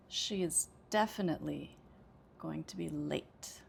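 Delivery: two measured chunks, "definitely" and "late" standing out; pause between thought groups. Shown as a demonstration. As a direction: stress "definitely", pause, then land on a longer "late". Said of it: "Pay attention this time to when I pause".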